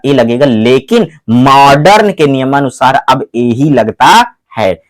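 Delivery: ordinary talk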